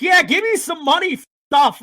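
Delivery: high, loud mock demand; mocking demanding brother. Freed brother demanding money from his rescuers, high mock shout. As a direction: shout it like a greedy punk. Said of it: "and he's like".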